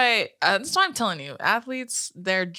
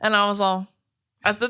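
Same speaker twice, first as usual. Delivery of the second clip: ordinary talk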